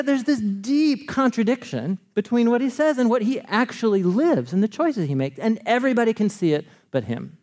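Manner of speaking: normal talking voice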